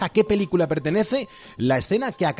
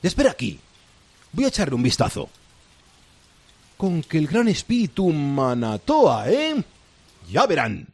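villainous pirate captain. Acted. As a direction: gloat like a sly pirate captain. Pirate captain goes to investigate.

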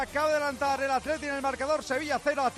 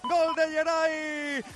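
drawn-out goal shout; 3 semitones higher, much slower than usual. Real, not acted. Goal call.

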